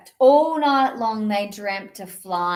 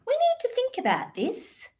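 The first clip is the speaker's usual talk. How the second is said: thinking kitten; 12 semitones higher, 4 dB quieter than usual. Acted. Kitten ponders.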